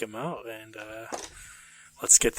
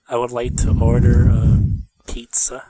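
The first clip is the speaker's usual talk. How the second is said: ordinary talk, nothing marked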